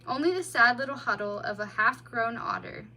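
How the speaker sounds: ordinary delivery, nothing marked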